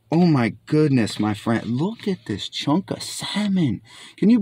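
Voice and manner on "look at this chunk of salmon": soft, slow, awed; almost breathless over the fish; hushed awe. Real, not acted. Marvelling at the fish.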